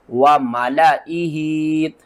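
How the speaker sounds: "वमलाइही" very slow, alif silent after lam; careful recitation. Shown as a demonstration. Teacher demonstrates the right reading, very slow. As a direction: stretch "वमलाइही" slowly, skip the alif. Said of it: "किस तरह पढ़ेंगे?".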